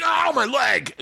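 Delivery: high yelp of pain; mock cry of pain. Injured football player crying out in class, high yelp. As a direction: yelp it like a hurt teenager.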